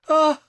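short, high, loud shriek; horrified high cry. Acted. Bess sees blood on her infant's clothes, shriek. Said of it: "shrieked Bess".

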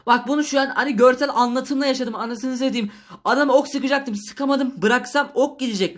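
high-pitched, exasperated outburst; agitated rant. Real, not acted. Gamer telling viewers he couldn't release the arrow, agitated. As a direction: protest in frustration, pitch held up high. Gamer ranting after being caught.

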